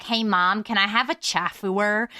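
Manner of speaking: lightly voiced little kid asking; playful imitation of a child's request; imitated five-year-old. Five-year-old self asking Mom, lightly imitated child. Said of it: "five years old, I'm like".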